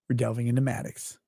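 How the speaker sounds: normal talking voice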